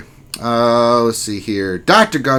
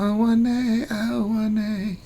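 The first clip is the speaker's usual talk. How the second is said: very high, drawn-out falsetto wail; mock dismay; 9 semitones higher, 7 dB quieter, much slower than usual. Streamer wailing over a viewer's collapsed guild, mock dismay. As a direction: play up a squeaky lament.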